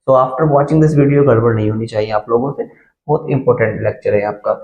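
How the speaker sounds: ordinary talk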